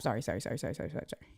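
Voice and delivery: low, trailing string of apologies; soft, low, fading to almost nothing; muttered apology. Real, not acted. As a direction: mutter it under your breath, fading away. Apologizing while catching up on comments.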